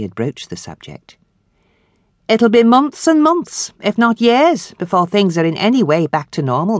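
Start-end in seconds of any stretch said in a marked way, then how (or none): 2.3-6.8 stern manageress. Acted. Miss Perkins voiced.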